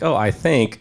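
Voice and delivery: mock hesitation. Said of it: "I'm like".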